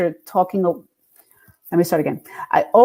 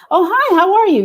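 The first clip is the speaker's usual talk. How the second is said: much higher, louder polite hello; high phone greeting; 11 semitones higher, 4 dB louder than usual. Retold or imitated. Singer greeting a program director on the phone, high-pitched.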